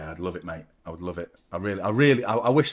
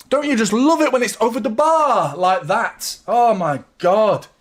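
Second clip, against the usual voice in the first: much louder, higher, frantic relief; relieved shouting; 10 semitones higher, 6 dB louder than usual. Real, not acted. Streamer reacting to a near own goal, frantic shouting. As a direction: yell through the shock of the near miss.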